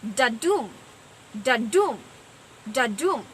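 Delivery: "Da-dum" with weight on the second syllable, dum; unstressed-stressed beat on each "da-dum"; iambic stress. Shown as a demonstration. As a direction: say "da-dum" as light da, stressed dum, with pauses between. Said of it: "combination of unstressed and stressed".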